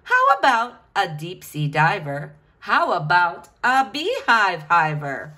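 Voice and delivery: ordinary delivery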